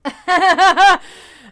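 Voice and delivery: sudden loud burst of laughter. Real, not acted. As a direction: crack up out loud. Big laugh at viewer comment.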